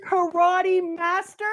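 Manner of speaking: high, slow disbelieving question; incredulous. Real, not acted. Fan stunned the girl learned fishman karate, high and slow. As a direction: drag it out in disbelief. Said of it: "disbelieving repeat".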